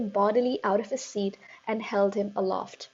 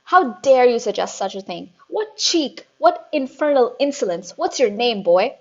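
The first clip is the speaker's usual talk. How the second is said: furious headmistress; 3 semitones higher, 9 dB louder than usual. A narrator's reading. Headmistress raging at a cheeky pupil, loud. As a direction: rage at the cheeky boy. Trunchbull outrage.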